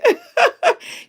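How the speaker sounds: real high embarrassed laugh; high squealing laugh. Real, not acted. Laughing at her own crying.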